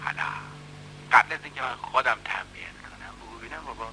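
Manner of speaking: menacing low murmur; quiet menace. Acted. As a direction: lean in and threaten him under your breath. Man threatens the boy quietly.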